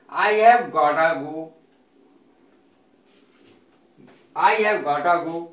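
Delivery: I have gotta go, slow and clear with gotta run together; reduced gotta. Shown as a demonstration. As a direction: say gotta as one word, slowly. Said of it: "Got to को gotta बोल दिया". (धीमे से gotta बोलकर दिखाना)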